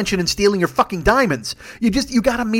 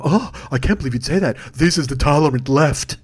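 low, put-on scandalised voice; mock pearl-clutching dismay; hammed-up offended prude; pretend offence; 4 semitones lower than usual. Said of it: "they pretend that the language bothers them".

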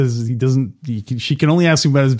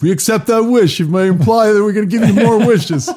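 higher, mock lawyerly voice; a much higher pompous legal hedge, half laughing; wish lawyer; 9 semitones higher, 3 dB louder than usual. Wish lawyer Hagen parody.

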